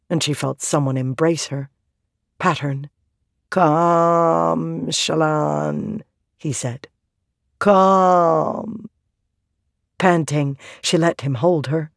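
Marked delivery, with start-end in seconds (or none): 3.5-6.0 long-held, droning syllables in an odd voice; Pattern's voice. A narrator's reading. Pattern voiced as he calls Shallan. 7.6-8.9 one long, droning, held word; Pattern's voice. A narrator's reading. Pattern voiced as he calls Shallan.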